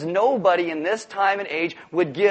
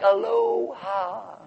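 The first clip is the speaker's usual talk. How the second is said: long, drawn-out aloha; laid-back Hawaiian greeting; much slower than usual. Acted. Jesus greeting men as at a luau, drawn-out and easygoing. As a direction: stretch it like a luau host. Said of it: "like you're in Hawaii".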